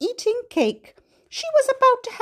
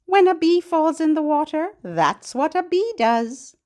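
ordinary talk, nothing marked

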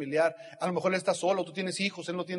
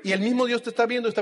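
loud preaching; 4 semitones higher, 9 dB louder than usual. Real, not acted. Speaker to crowd about God's wind, loud preaching. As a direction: proclaim it loud, stressing mismo Dios.

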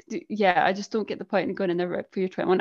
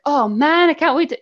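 higher, louder mock excitement; mock eagerness; 6 semitones higher, 5 dB louder than usual. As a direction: play up being gripped by a cliffhanger. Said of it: "I'm not like".

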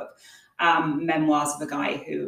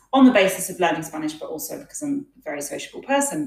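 usual speaking voice

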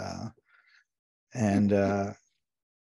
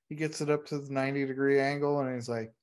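normal talking voice

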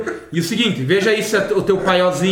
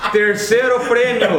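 prize read through laughter; laughing; 3 semitones higher, slower than usual. Real, not acted. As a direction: laugh while announcing.

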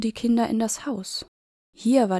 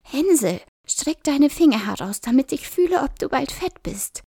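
put-on high, loud greedy old witch; 6 semitones higher, 4 dB louder than usual. A narrator's reading. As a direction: call into the cage, coaxing.

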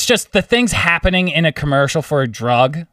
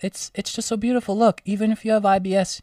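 high, gushing, softer mock-sentimental voice; Joanne impression; 4 semitones higher, 6 dB quieter than usual. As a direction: gush over the ad like it moved you to tears. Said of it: "And Joanne Winston's just like".

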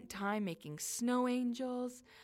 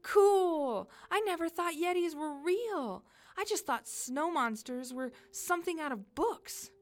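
high, loud wonder; thrilled child; 9 semitones higher, 6 dB louder than usual. Acted. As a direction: gush with amazement at meeting a Yeti. Girl thrilled to meet a Yeti.

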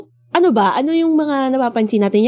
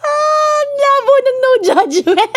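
laughing; slower than usual. Real, not acted. Laughing at cohost's remark.

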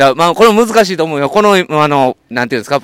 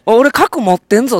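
mock tough boast; 8 semitones higher than usual. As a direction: puff up like a bully bragging. Voicing a nuclear-armed boast. (高めの声でふざけた強がり)

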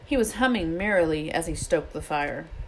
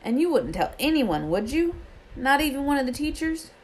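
higher, questioning, a touch worried; anxious; 9 semitones higher than usual. A narrator's reading. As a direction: seek reassurance that the secret is safe. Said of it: "said Harry anxiously".

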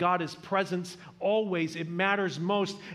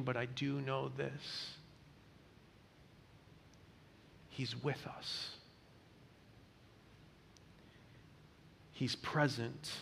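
slow, much softer comfort; tender reassurance; 3 semitones lower, 12 dB quieter, slower than usual. Acted. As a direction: say it gently, as to someone grieving. Comforting mourners with shared words.

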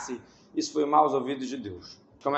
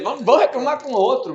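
mock complaining; 10 semitones higher, 5 dB louder than usual. Mocking people's complaining attitude.

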